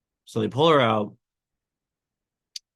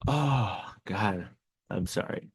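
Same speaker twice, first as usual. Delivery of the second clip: soft, tearful apology, fading quieter; real crying; 6 dB quieter than usual. Real, not acted. Dad apologizing to viewers while crying for real. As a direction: catch your breath through real tears. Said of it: "I'm crying, of course, like I am now".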